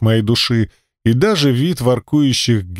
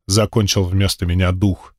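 ordinary delivery

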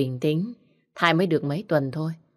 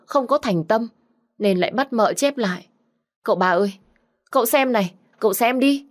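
higher and louder, tattling in a rush; eager accusation; 4 semitones higher, 4 dB louder than usual. Acted. Maid denounces Châu to the young master, loud pleading tattle. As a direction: pour out the complaint, pleading. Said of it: "nhanh miệng tố cáo chị Châu".

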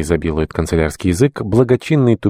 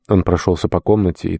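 plain reading voice

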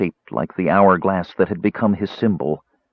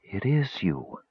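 breathed; 8 dB quieter, slower than usual. Acted. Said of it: "Chrysania breathed".

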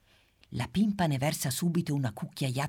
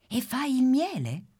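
eager question; 11 semitones higher, 4 dB louder than usual. Acted. Pimpa asks the bee.

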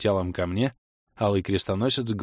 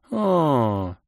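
higher, falling moan; drawn-out groan; 4 semitones higher than usual. A narrator's reading. Kelen reacting to the lovey pet names, drawn-out groan. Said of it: "простонала Кэлен".

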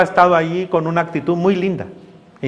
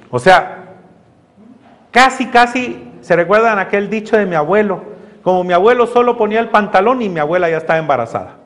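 normal talking voice

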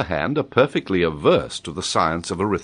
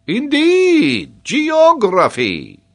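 loud, very high exclamation, drawn out; excited interest; 8 dB louder, much slower than usual. A narrator's reading. Geographer pricks up his ears at the subject, loud excitement. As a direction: pounce on it like a thrilled geographer. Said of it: "pricked up his ears at this".

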